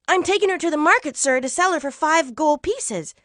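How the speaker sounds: put-on quick, high boy's voice; young boy Jack. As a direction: pipe up quickly to a grown-up. Boy Jack answers the stranger.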